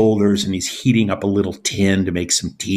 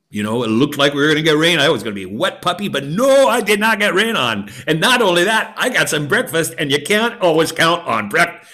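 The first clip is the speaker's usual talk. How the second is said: excited rambling; 8 semitones higher than usual. Acted. Beggar tells king why he is happy, fast and high. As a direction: rattle it off, then crow about breakfast.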